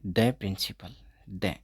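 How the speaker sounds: "द प्रिंसिपल" with a short duh; the before a consonant. Shown as a demonstration. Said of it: "तो इसको क्या बोलेंगे?". (कॉन्सोनेंट से पहले छोटा "द")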